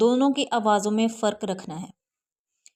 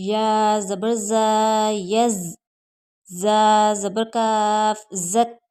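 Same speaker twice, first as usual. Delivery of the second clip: slow, drawn-out letter-by-letter recitation; slow spelling drill; much slower than usual. Shown as a demonstration. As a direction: spell each letter out slowly for the class. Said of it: "यहाँ दोनों हरूफ को सख्ती के साथ पढ़ना है".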